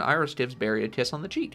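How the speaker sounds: usual speaking voice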